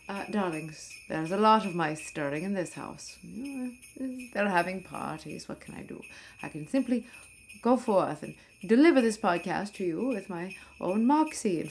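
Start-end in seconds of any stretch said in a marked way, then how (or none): 5.5-6.0 breathless little whisper. Acted. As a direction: drop to a secret whisper. Sudden aside about the mice.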